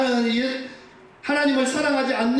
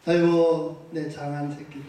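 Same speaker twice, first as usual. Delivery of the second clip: fond endearment; 7 semitones lower than usual. Pretend. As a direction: croon it fondly and let it fade. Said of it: "하나님께서 여러분의 이름을 부르면서". (낮고 점점 작아지는 다정한 부름)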